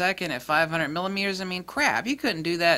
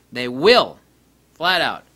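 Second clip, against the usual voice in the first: "They will." repeated much louder; emphatic; 3 semitones lower, 8 dB louder than usual. Shown as a demonstration. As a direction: slam down "They will." as a final word.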